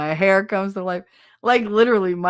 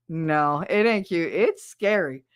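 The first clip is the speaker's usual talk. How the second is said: ordinary talk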